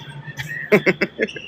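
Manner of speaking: short high unvoiced laugh; a quick embarrassed giggle; high breathless laugh. Real, not acted. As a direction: laugh at your own slip. Laughs at misreading in heat.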